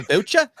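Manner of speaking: Boucher blurted high and loud as BOH-cher; hammed-up mispronunciation. Shown as a demonstration. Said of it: "Say it with me".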